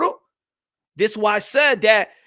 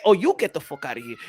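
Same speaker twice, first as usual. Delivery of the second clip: low mock threat; 9 semitones lower, 4 dB quieter than usual. Mock Canelo threatening Eddie.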